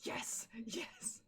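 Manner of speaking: breathless whispered yes yes yes; whispered glee at finding the song; excited whisper. Retold or imitated. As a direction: whisper it like a secret thrill. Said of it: "And I was like".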